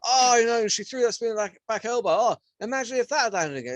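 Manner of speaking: imitating Paul Felder. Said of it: "Paul Felder was just like".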